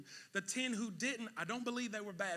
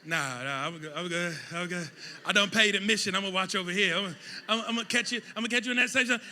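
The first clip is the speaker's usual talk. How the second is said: reluctant onlooker; 11 dB louder than usual. Retold or imitated. Onlooker refusing the wheelbarrow.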